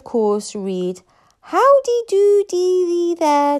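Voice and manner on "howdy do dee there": high, loud, drawn-out dragon call; shrill Dragonese. A narrator's reading. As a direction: stretch it out in a shrill dragon-language sing-out. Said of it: "shrill shrieks and popping noises".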